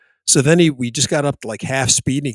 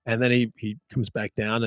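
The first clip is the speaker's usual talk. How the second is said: usual speaking voice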